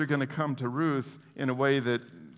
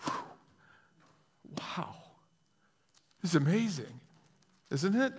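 stunned wonder; 4 semitones higher, 4 dB quieter than usual. Acted. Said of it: "stops cold and says".

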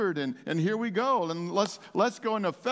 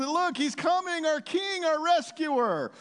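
very high, excited heralding; jubilant acclaim; 10 semitones higher than usual. Acted. Crowd hailing their coming King and rescuer, jubilant cry. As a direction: cry out as the King comes into view.

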